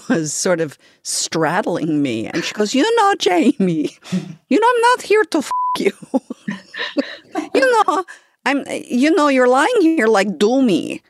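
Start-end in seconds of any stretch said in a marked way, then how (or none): 2.8-5.9 much higher, fast, hammed-up Brazilian accent; squeaky, exaggerated Brazilian accent, poking fun; Ruthie's Brazilian accent. Masseuse correcting client on the table, in a hammed-up Brazilian accent. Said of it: "my terrible Ruthie accent". 7.6-11.0 high, loud, hammed-up Brazilian accent with a laugh; overdone Brazilian accent, laughing through it; Ruthie's Brazilian accent. Masseuse mocking client lying there, in a hammed-up Brazilian accent. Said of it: "my terrible Ruthie accent".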